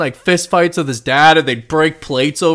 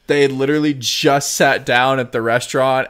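ordinary talk

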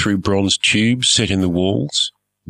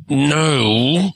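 tight annoyed no; through gritted teeth; 7 semitones higher, 3 dB louder than usual. Acted. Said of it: "through gritted teeth".

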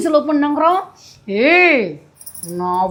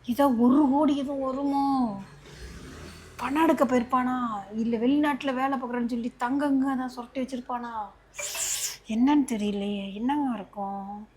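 much softer, muttered to herself; scheming aside; 10 dB quieter than usual. Acted. Wife secretly wonders aloud what money the husband left. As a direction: mutter your greedy guesses under your breath.

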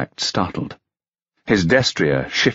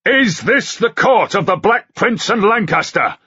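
furious knight; furious shout; 13 semitones higher than usual. A narrator's reading. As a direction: rage at the whole court. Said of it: "he shouted furiously".

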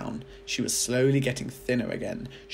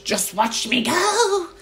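higher, louder, slow gloating crone; screechy old grandma; 8 dB louder, slower than usual. A narrator's reading. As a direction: drag out the boast like a cackling witch. Grandma crowing while growing.

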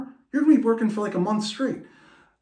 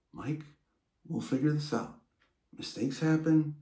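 reassuring mom; 8 dB quieter than usual. Retold or imitated. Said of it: "My mom's like".

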